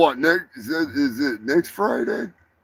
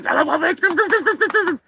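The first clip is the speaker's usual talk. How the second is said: very high fast calling voice; high squeaky calling; 3 dB louder, much faster than usual. Acted. Character calling someone over, very high and urgent. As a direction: beckon in a tiny shrill voice.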